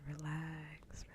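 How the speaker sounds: ordinary talk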